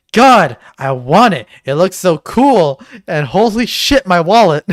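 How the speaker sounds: loud, excited fan outburst; excited gushing. Real, not acted. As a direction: gush with big swoops on key words. Gushing over the new game.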